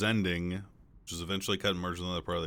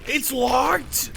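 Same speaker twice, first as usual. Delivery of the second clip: very high, loud yell; frustrated yell; 9 dB louder than usual. Real, not acted. Gamer finding the door locked, frustrated high yell. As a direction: yell it out in shrieking outrage.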